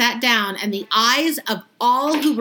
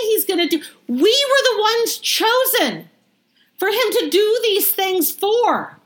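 higher, gushing mock delight from the crowd; mock excited crowd; 7 semitones higher than usual. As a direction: play the thrilled townsfolk, slowing on the big words. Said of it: "And they're going".

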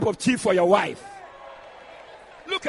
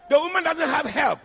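usual speaking voice

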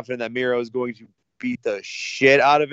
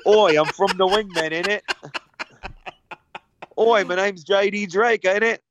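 hammed-up Cockney, higher and brighter; mock British accent; 8 semitones higher than usual. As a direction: put on a jokey London geezer voice. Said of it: "starts talking with a British accent".

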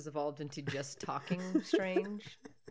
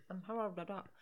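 duck bill voice; 7 dB quieter, faster than usual. Shown as a demonstration. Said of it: "imitating duck talk".

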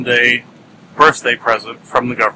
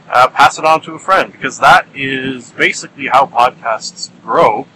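normal talking voice

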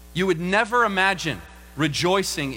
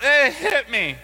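higher, teasing dare with a laugh; a child daring his sibling; imitating a kid; 5 semitones higher, 3 dB louder, slower than usual. Child voiced in story.

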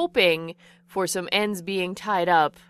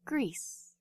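whispered on-screen caption; fancy voice; 4 semitones higher, 10 dB quieter than usual. Pretend. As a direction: whisper the place name like a title card. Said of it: "I have to use my fancy voice".

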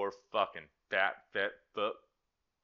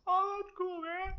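high dude voice. Pretend. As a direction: answer like a squeaky buddy. Second dude in dialogue.

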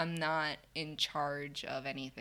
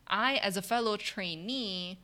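ordinary talk

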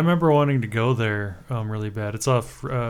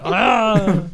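a loud, higher yelp of "ah"; high shout; 10 semitones higher, 7 dB louder than usual. Real, not acted. Cohost joining the word-of-the-day yell. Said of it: "you have to yell, "Ah!".

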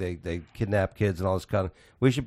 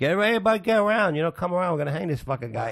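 high, fast mock crowd call; rally the crowd to the hanging; town crier; 8 semitones higher, much faster than usual.

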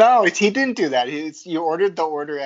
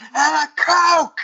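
put-on high, loud, slow drawn-out kid shout; slow, loud kid demand imitated; kid yelling; 7 dB louder, much slower than usual. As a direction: bellow it like a little kid. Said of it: "screams at the top of his lungs".

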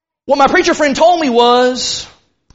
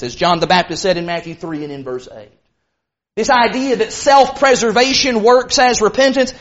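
ordinary talk, nothing marked

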